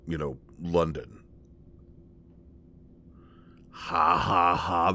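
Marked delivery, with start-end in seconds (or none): 3.7-4.9 slow, deadpan fake laugh; sarcastic laugh. Answering Bats's London joke, deadpan sarcastic laugh. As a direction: spell out the laugh with no amusement.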